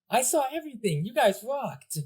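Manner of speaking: high, eager fan voice; excited cheering. Acted. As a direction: burst out with admiration. Villager cheering the heroes.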